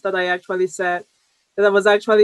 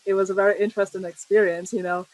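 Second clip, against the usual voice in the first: ordinary talk, nothing marked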